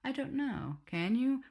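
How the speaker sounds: lightly hammed teacher comeback; teacher's stock retort. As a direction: play the smug teacher line. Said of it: "it's a job requirement to always say".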